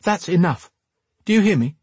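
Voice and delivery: high, sharp reprimand; snapped. A narrator's reading. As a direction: scold him firmly, pitch jumping up. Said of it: "Lenora snapped".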